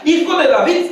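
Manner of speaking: high, mildly shouted quotation; retold cry. Preacher wonders why Bartimeo said this, imitated calling out. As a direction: imitate the blind man's call. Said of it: "pero él más gritaba".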